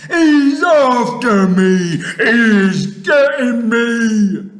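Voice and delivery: slow, high, wailing giant shriek; terrified scream. A narrator's reading. Giant pursued in his nightmare, screaming in terror. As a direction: drag out each word at full volume. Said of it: "screamed the flesh lump eater".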